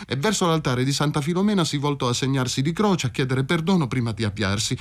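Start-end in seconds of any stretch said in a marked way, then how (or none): none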